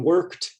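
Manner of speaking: "worked" ending in a t sound. Shown as a demonstration. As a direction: say "worked" as one syllable, silent E, final t. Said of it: "the E D sounds like t".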